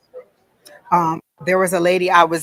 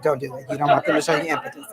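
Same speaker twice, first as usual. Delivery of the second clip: laughing; 4 semitones lower, faster than usual. Real, not acted. Laughing aside about impotence.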